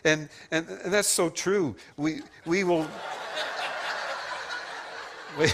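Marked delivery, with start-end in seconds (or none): 2.9-5.3 a real unvoiced laugh, far off the mic; breathy laugh. Real, not acted. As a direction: let the laugh slip out. Audience reacts to the card.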